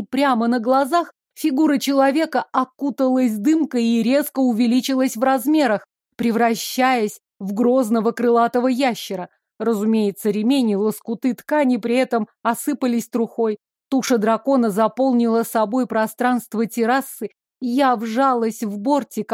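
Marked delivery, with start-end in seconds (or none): none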